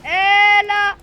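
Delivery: high, loud shouting for help; panicked call for help. Real, not acted. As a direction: yell for backup in a panic. Appelle à l'aide en combat. (crier à l'aide, paniqué)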